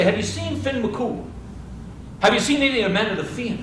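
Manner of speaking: high-pitched, searching questions; eager questioning. Acted. Oisin asking everyone for his father, eager questioning. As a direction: ask it high, eager for news.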